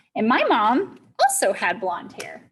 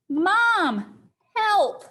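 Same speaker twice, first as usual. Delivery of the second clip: raised, high mock cry for rescue; screaming for help; 9 semitones higher, 3 dB louder than usual. Child in a tree to mom, mock screaming. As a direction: call out high, as if stranded. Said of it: "I'm crying and I'm screaming".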